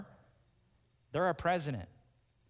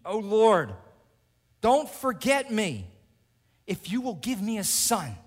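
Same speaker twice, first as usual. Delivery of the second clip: desperate crying out; 8 semitones higher, 10 dB louder than usual. Acted. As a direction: wail it up to heaven. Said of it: "she's crying out in desperation".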